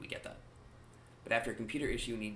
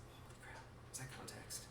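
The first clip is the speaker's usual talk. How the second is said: a hushed, mock-amazed whisper; mock-awed whisper; 3 semitones higher, 17 dB quieter than usual. Vlogger mocking rare cleverness, hushed mock awe. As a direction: gasp it quietly as if stunned, but teasing.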